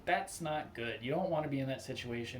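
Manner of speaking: ordinary talk, nothing marked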